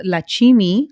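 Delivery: "Lachimi" said la-CHEE-mee; three syllables in "Lachimi", la-chee-mee; nickname pronunciation. Shown as a demonstration. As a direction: say "Lachimi" with a ch and a long middle ee. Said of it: "is the nickname".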